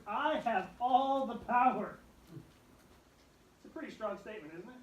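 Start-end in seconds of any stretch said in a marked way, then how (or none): 0.1-2.0 loud, slow, every word weighted; booming declaration. Acted. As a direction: proclaim it with full authority. Jesus quoted with full force.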